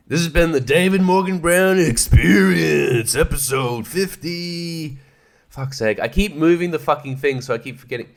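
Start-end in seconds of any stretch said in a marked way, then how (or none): 0.1-5.0 slow, drawn-out host sign-off, a little higher; podcast outro. Acted. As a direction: announce the end of the episode slowly like a radio host.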